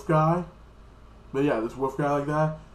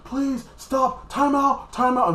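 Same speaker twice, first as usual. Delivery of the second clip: high, loud mock begging; hammed-up desperate plea; pleading bear; 12 semitones higher, 5 dB louder, much slower than usual. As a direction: beg for a break in a high, urgent voice. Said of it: "and the bear like this".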